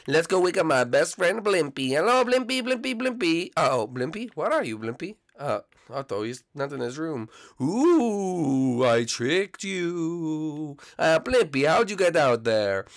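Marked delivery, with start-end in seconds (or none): 1.9-3.5 calling out. Acted. Calling out to wake Blimpy. 7.6-10.8 teasing ghost. Acted. Ghost Blimpy teasing Luigi.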